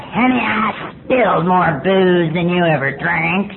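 slow, drawn-out old-timer put-on; mocking old-timer. Old fart in the rooms bragging to a newcomer, mockingly slow. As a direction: lightly mimic a know-it-all old drinker. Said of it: "all them old farts who say brilliant things like".

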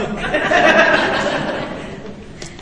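high unvoiced laugh; breathy laugh. Real, not acted. After joking about her husband, a breathy laugh.

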